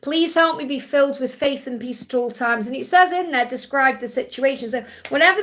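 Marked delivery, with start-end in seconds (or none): none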